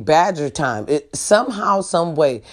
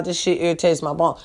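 ordinary talk, nothing marked

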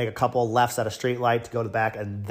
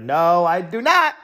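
higher, slow, re-enacted firm refusal; emphatic refusal; 7 semitones higher, 4 dB louder, slower than usual. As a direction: refuse a guard point-blank, stretching the words.

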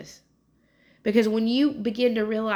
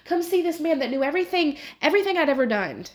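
much higher, urgent calling to townsfolk; excited proclaiming; 8 semitones higher than usual. Retold or imitated. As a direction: call everyone over to see, voice pitched high. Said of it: "she is just proclaiming Jesus to the whole town".

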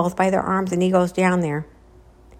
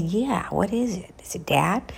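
breathless little whisper in the dark; frightened whisper; 5 dB quieter than usual. Retold or imitated. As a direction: whisper it, scared of being heard at night. Brothers at night hear noise.

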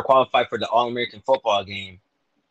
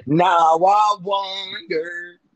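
normal talking voice

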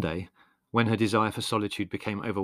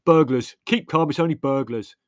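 loud, higher yell; 5 semitones higher, 8 dB louder than usual. A narrator's reading. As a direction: yell it out to calm everyone. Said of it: "yelled Leslie".

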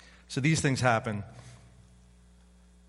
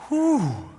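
loud, high whew sliding down; big falling "whew" of release; relieved whew; 8 semitones higher, 4 dB louder than usual. Acted. Preacher showing how satisfying praying feels, big relieved whew. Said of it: "You're like".

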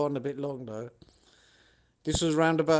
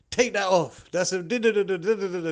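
loud, high barked order running into nonsense syllables; imitated hectoring preacher; 5 semitones higher, 5 dB louder than usual. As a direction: mimic an angry preacher ordering someone, then rattle off da-das. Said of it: "this is the kind of preacher he was".

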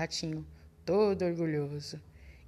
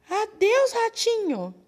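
much higher and louder frantic farewell; panicked goodbye; 7 dB louder, slower than usual. Acted. Fox fleeing the gruffalo, high frantic goodbye. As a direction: blurt it out while running away.

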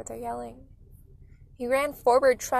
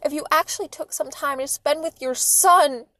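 loud, higher-pitched angry retort; raised-voice reproach; yelling; 4 semitones higher, 8 dB louder than usual. A narrator's reading. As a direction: keep yelling as the fed-up mother. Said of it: "Mom yelled".

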